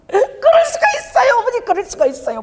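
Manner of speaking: high, wailing reenacted plea, easing off; tearful protest. Preacher replays pleading with mother on the phone, tearful protest. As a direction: act out crying down the phone, pitch falling. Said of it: "막 이러고 막 울면서".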